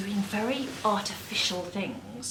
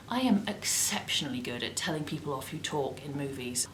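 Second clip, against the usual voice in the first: hushed cinema whisper; 5 semitones lower, 6 dB quieter than usual. Real, not acted. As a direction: whisper as if in a movie theatre. Cinema hush theme.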